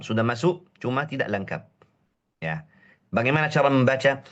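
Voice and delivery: ordinary talk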